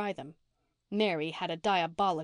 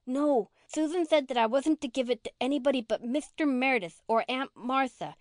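put-on higher, firm little girl insisting; insistent; 5 semitones higher than usual. A narrator's reading. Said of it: "insisted Rilla".

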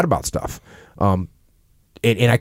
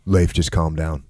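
much lower, softer brush-off; dismissive calm-down; 8 semitones lower, 5 dB quieter than usual. Shown as a demonstration. Boss dismissing an angry subordinate, lower and softer. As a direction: wave his anger away, flatly. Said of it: "right there, I've already taken".

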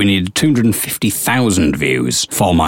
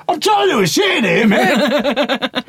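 much higher, laughing mock bloke voice; imitating a bloke; slower than usual. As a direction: play the annoyed man heard in the background. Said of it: "What you can hear, hear in the background is".

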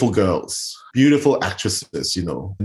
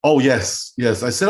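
normal talking voice

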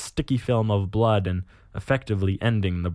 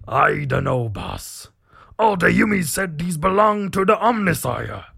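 mildly timid orc underling, rising higher; 6 semitones higher than usual. Acted. Orc boy timidly answers his boss, hesitant orc voice. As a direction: doubt the boss with a touch of timidity. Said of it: "One of his boys timidly responds".

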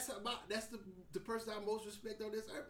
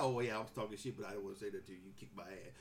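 low, sheepish backing-down voice, lighter and softer; a grown son caving in; meek son; 13 semitones lower, 5 dB quieter, faster than usual. Retold or imitated.

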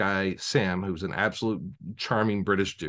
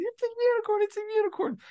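very high falsetto squeal; excited squealing. Retold or imitated. Players spotting the unicorn on the hill, excited high squeal. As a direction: imitate thrilled players squealing. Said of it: "they're all getting excited".

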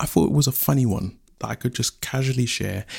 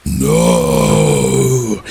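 deep, loud anguished no; Fire screams; 11 semitones lower, 6 dB louder than usual. Acted. Fire doused by rain, deep scream. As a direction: scream as you're put out. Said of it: "he screamed".

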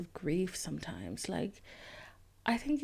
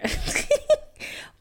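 high loud burst of laughter; scoffing laugh; incredulous laugh; 8 dB louder than usual. Retold or imitated. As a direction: laugh at how absurd it is. Laughs at the insult.

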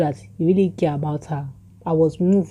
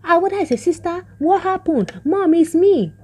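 put-on shocked children; 12 semitones higher than usual. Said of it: "those one were like".

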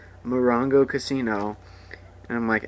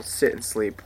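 usual speaking voice

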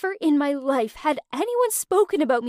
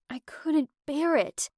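ordinary delivery, nothing marked

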